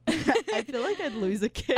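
laughing. Real, not acted. Friends joking, laughter heard.

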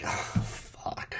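breathy under-breath whisper; whispered curse. Retold or imitated. Dismayed at her thumbs down, whispered curse. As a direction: let it slip under your breath.

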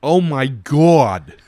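loud, high, very drawn-out gushing; broad New York gusher, slow and loud; imitating a woman at the bar. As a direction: stretch it out in loud mock amazement. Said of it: "this woman comes over to me and she goes".

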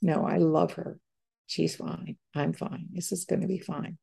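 hushed calm, like a private realisation; quiet calm certainty. Retold or imitated. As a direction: murmur it as sudden peace arrives. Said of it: "all of a sudden I was like".

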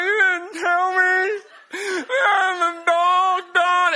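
high, slow, laugh-crying wail of betrayal; mock sobbing wail. Preacher retelling calling his friend, mock sobbing. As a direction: wail it through comic tears, way up high. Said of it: "I was like".

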